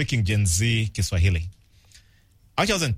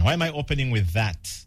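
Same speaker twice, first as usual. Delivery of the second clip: normal talking voice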